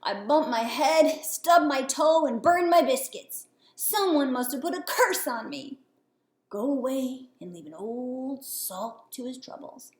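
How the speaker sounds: put-on old captain grumbling at the frog; grumpy old sailor. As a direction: moan about your bad luck.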